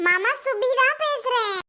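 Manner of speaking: put-on high, excited child on the phone; eager greeting. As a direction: announce yourself eagerly, pitch lifted. Child eagerly greets missed uncle.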